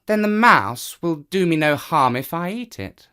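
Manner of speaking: the smug cat claiming his place. Acted. Cat voiced in the story.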